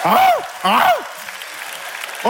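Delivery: very high, loud barks; imitated dog bark. Preacher imitating a dog for the congregation, high barking. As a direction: yelp out like a dog.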